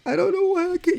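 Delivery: high, pleading mock whimper; imitating sister's teary bewilderment. Sister imitating her drugged sister's high teary plea. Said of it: "you kept saying".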